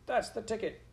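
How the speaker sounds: high, squeaky mouse cheer; crowd mouse calling. A narrator's reading. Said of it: "shouted a mouse in the crowd".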